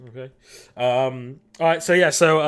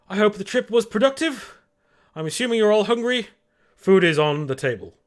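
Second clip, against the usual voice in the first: high, louder butler-like host voice; Hamish greeting the crew; 10 semitones higher, 5 dB louder than usual. Acted. Hamish welcomes the crew back, polite robotic host voice. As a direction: play the robot-handed host announcing dinner. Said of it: "just steps out and just says".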